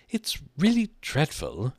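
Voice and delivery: high-pitched grumble to oneself; muttered complaint. A narrator's reading. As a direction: complain to yourself in a high girl's voice. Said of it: "she muttered to herself".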